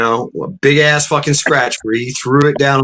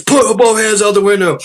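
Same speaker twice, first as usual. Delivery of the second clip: high-pitched retold police command; imitated trooper's sharp command; barked order; 7 semitones higher than usual. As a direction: order a driver like an angry cop.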